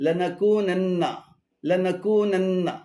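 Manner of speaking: slow, low "লানাকুনান্না" as one word; correct form. Shown as a demonstration. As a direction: join "লানাকুনান্না" fully. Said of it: "শুদ্ধ উচ্চারণ হবে". (ধীরে একটানা "লানাকুনান্না")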